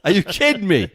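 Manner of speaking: high-pitched, laughing incredulity; mock disbelief. Telling how the rain stopped, in mock disbelief. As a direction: retell it in comic disbelief. Acting out his disbelief afterwards.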